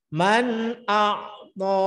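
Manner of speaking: Man akto drawn out slowly with a long a; Man akto chanted slowly, the a stretched; long reading. Shown as a demonstration. Teacher demonstrating the long reading to students, slow. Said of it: "Baca panjang gantinya".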